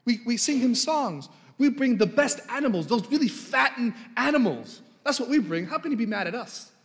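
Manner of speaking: a light touch of smug self-justifying people; smug Israelites. Acted. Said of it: "You can imagine the Israelites saying".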